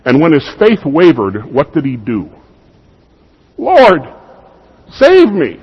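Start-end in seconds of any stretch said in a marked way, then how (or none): 3.6-5.6 high, loud cry for help; desperate cry. Pretend. Preacher voicing Peter sinking and crying to his Lord for rescue. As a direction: call out as if sinking.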